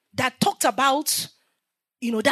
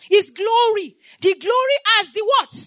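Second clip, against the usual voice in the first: preacher's rising call; 6 semitones higher than usual. Real, not acted. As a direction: lift it up and call out the question. Preacher calls out to congregation.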